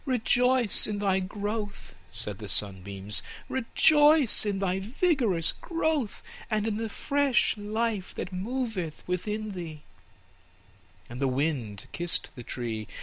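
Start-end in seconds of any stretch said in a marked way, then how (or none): 0.1-1.9 high, slow, gentle sunbeam voice; gentle slow sunbeam chant. Acted. Sunbeams speak to the tree. 3.5-9.8 sunbeams. Acted. Sunbeams speak to the tree.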